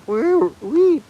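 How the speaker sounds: high whistly wee wee like a bird; playful birdsong mimic; bird call imitation. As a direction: chirp back at the bird. Answering the bird's call.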